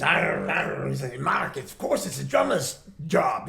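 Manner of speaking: lightly voiced Scottish accent, slow; a hint of a Scottish drummer. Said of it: "the strongest Scottish accent".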